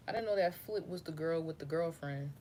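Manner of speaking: normal talking voice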